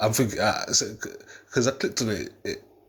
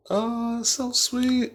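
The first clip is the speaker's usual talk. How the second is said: high-pitched mock cooing; unmoved girlfriend; much slower than usual. Girlfriend reacting to his proposal without being into it, mock sweet. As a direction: put on a high, insincere little coo. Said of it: "She didn't even seem into it".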